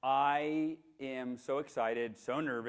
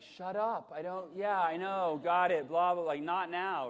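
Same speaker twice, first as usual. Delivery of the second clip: annoyed; 5 semitones higher, 5 dB louder, slower than usual. Retold or imitated. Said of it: "when you don't wanna hear it".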